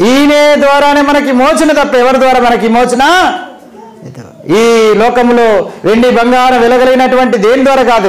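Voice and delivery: high, lifted pulpit voice building phrase by phrase; fervent preaching. Acted. Pastor urging listeners on redemption through Christ's blood, high and fervent. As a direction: drive the point home from the pulpit.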